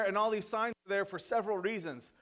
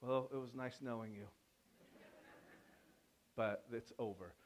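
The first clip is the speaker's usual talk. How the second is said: much softer, lower, fatalistic murmur; resigned doom; 7 semitones lower, 10 dB quieter than usual. Retold or imitated. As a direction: say goodbye to life, quietly. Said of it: "they have this look in their eyes like".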